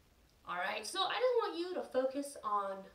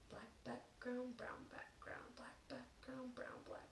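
whispering; 17 dB quieter, slower than usual. Shown as a demonstration. Tongue twister, fast and soft.

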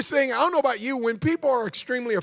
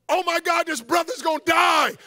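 high-pitched panicked exclamation; mock terrified outcry; 6 semitones higher than usual. Preacher reenacts his fear in third person, mock panicked cry. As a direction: cry out in exaggerated alarm. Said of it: "when I'm afraid like that".

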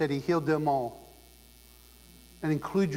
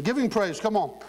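loud, high rallying cry to the crowd; preacher's rousing call; 6 semitones higher, 3 dB louder than usual. Real, not acted. Preacher rousing the congregation to praise, loud rallying cry. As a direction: lift the room, call out for praise.

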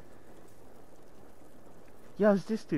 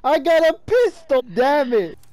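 frustrated shout; 7 semitones higher, 7 dB louder than usual. Real, not acted. Disappointed loot shout.

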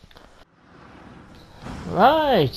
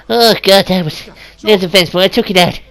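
ordinary delivery

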